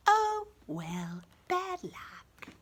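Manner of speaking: usual speaking voice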